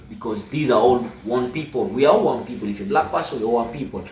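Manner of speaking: usual speaking voice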